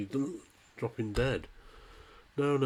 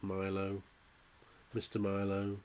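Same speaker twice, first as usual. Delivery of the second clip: usual speaking voice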